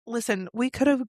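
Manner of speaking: ordinary talk, nothing marked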